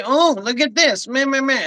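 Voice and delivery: high, sneering mock of a bored viewer; mocking scroller. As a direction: toss it off like someone who can't be bothered. Mocking a viewer who scrolls past.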